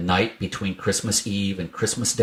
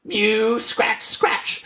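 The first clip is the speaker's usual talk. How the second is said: scratching cat; 13 semitones higher, much slower than usual. A narrator's reading. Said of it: "scuffled Simpkin".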